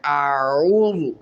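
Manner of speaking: आऊज़ु slow with throaty ain; careful recitation of आऊज़ु; correct आऊज़ु. Shown as a demonstration. Teacher models Quranic pronunciation, slow careful. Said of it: "ख्याल रखना है".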